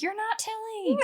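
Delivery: high squeaky laughing quote; laughing mock voice. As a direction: giggle through a silly high voice. Mock voice of possessed boy.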